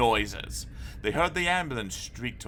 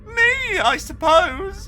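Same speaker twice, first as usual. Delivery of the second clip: high, loud, breaking into howls; howling; 10 dB louder, much slower than usual. A narrator's reading. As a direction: crack up as you confess. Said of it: "howled Augustus".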